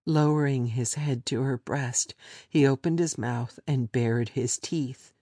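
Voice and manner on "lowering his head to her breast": ordinary delivery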